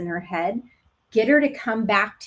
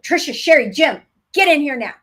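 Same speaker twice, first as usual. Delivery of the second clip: put-on loud, higher call of a mother summoning kids; mom voice; 5 semitones higher, 7 dB louder, faster than usual. Mother calling her children inside, loud mom voice. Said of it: "my mom is like".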